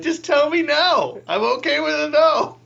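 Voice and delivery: high, loud, slow pleading; pleading protest. Retold or imitated. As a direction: plead to be turned down. Begging to be refused.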